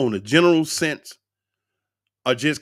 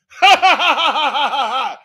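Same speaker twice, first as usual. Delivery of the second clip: loud, high real burst of laughter; 9 dB louder than usual. Real, not acted. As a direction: let a big laugh explode out. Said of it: "I just started laughing".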